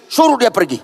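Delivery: high-pitched, bothered order; annoyed demand. Acted. Annoyed disciples ask Jesus to dismiss her, bothered demand. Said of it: "muridnya terganggu".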